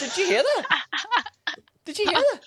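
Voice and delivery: hammed-up fright about screaming outside; mock spooked. Imitating a spooked teacher on the school trip, mock alarmed.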